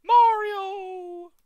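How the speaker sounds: very high, loud princess cry; cartoon princess. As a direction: shriek his name like Princess Peach. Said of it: "do your best Princess Peach impression".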